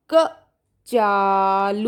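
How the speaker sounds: very slow drawn-out कचालू; dictation word. Shown as a demonstration. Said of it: "अगला शब्द मैं बोलती हूँ".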